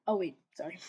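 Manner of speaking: plain reading voice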